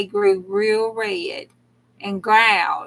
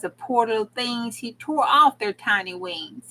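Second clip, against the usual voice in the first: ordinary delivery, nothing marked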